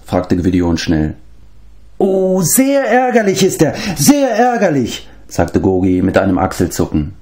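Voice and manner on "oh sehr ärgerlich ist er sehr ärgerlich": a pitiful little creature complaining; whining beast-man. Acted. Gurgi complains he gets no thanks, high whining creature voice.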